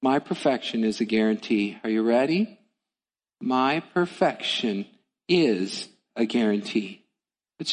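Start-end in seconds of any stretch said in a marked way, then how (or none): 3.4-7.0 slow, weighty repeat of the promise; slow and deliberate. Shown as a demonstration. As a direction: lean on MY, slowly. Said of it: "one more time".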